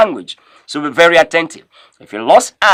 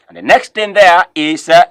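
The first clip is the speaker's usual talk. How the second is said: normal talking voice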